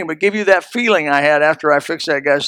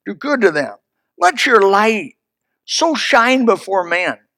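usual speaking voice

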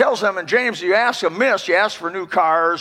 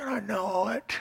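murmured admission; 3 semitones higher, 13 dB quieter, slower than usual. Retold or imitated. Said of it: "I said".

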